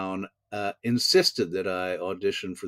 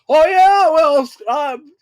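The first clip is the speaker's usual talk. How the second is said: high, loud sputtering; flustered; 11 dB louder, slower than usual. Retold or imitated. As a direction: caught off guard, stammer it out. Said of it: "I thought".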